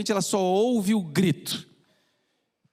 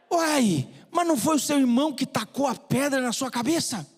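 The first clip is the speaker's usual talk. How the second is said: higher, incredulous mineiro "Uai"; the doctor can't believe it; surprised mineiro doctor; 6 semitones higher than usual. Acted. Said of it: "o médico era mineiro". (médico mineiro surpreso)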